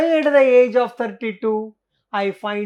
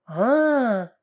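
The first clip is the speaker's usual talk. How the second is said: ordinary talk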